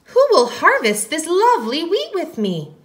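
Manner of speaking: high storybook hen voice; little red hen. A narrator's reading. Hen asks friends to help plant, high character voice. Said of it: "she said".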